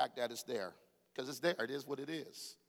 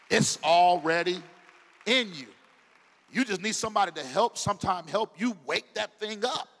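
preaching at full force; 4 semitones higher, 8 dB louder than usual. Acted. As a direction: build it up like a sermon climax.

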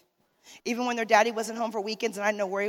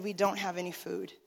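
softer, hesitant, forcing the words "We don't have any food." out; hard-to-admit quiet confession; 3 semitones lower, 7 dB quieter than usual. Retold or imitated. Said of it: "it was so hard for me to utter that out".

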